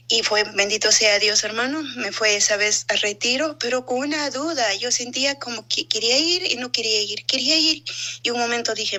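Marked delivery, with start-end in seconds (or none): none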